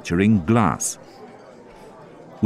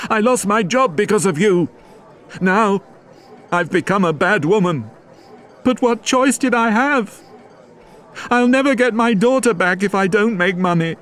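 shouting woman; 13 semitones higher than usual. Acted. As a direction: shout the blame at him, high. Fantine's bitter outburst at mayor.

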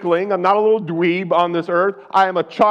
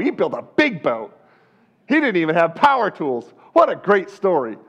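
mock enthusiasm. Mocking Christians who enjoy stories.

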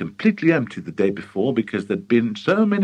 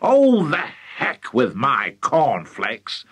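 excited cry; slower than usual. Acted. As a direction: burst out dismissively, then stretch it. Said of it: "cried Mister Cranky".

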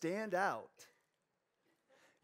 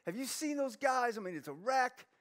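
higher-pitched mock protest; whining son; 5 semitones higher than usual. Jesus imagined protesting to his Father, mock whine. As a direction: complain like a reluctant kid to his dad. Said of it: "but he didn't go".

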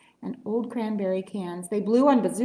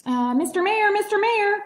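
higher, louder call to the mayor; 10 semitones higher, 5 dB louder than usual. A narrator's reading. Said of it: "Horton called".

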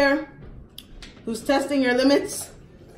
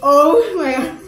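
laughing cry; 5 semitones higher, 8 dB louder, slower than usual. Real, not acted. Bite of very sour lemon.

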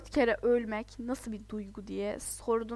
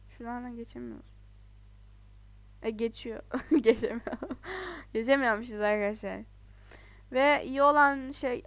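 usual speaking voice

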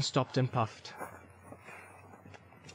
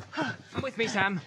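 breathless, high urgent order; winded, raised-pitch call; panting; 9 semitones higher, 3 dB quieter, slower than usual. A narrator's reading. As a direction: speak between pants while hurrying. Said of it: "panting and calling".

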